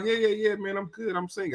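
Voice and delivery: sheepish soft reply; quiet mumbled reply. Retold or imitated. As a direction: mumble the reassurance away from the mic. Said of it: "I be like".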